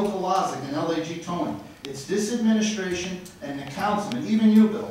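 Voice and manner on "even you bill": much louder and higher, calling out Bill; real shout. Real, not acted. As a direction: hit him with it, full voice. Calling out a councilman directly.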